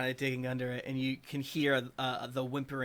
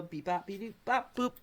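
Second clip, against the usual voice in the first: playful beep-bop noises, slightly higher; mock beeping; 6 semitones higher than usual. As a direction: make robot beeps with the mouth. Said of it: "spoken beeping noises".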